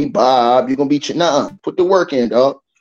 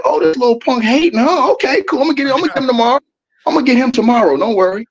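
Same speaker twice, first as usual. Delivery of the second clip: higher-pitched playful threat; mock menacing; 6 semitones higher than usual. Streamer retelling his answer to a hater, mock menacing. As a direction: brush off a hater with a mock promise of payback.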